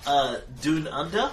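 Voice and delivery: "Doon Under" with a foreign oo vowel; mispronounced Down Under. Acted. Scientist mangling an Aussie phrase, "Doon Under" said oo. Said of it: "Oh, God, Doon Under".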